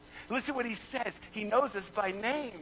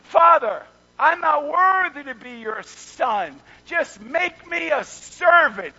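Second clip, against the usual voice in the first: loud, slow, pleading; hammed-up pleading son at full volume; pleading son, preached loud; 6 semitones higher, 10 dB louder, slower than usual. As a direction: cry it out as the humbled son. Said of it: "What does the son say".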